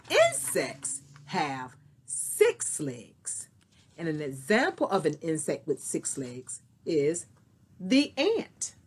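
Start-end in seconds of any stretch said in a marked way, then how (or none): 0.1-3.5 slow, deliberate classroom emphasis; slow teaching voice. Shown as a demonstration. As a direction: spell the fact out slowly. Teacher stresses key fact slowly.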